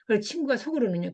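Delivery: ordinary talk, nothing marked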